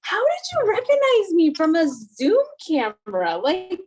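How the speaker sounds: high, loud incredulous question; mock disbelief, slowing on morning hair. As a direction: protest that she couldn't recognize you. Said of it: "I was like".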